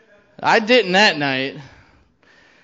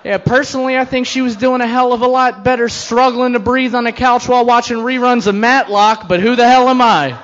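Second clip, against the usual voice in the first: sarcastic outburst; 7 semitones higher, 6 dB louder than usual. Acted. Sarcastic rant about dead wife.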